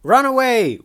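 high, loud cry to flee; panicked shout. Acted. Fleeing the giant rat scorpion, panicked shout. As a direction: yell it as an urgent warning.

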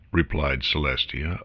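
usual speaking voice

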